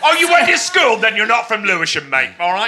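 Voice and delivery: loud mock-indignant shout; high-pitched shouting. Real, not acted. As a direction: shout back in mock outrage. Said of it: "high-pitched screaming at each other".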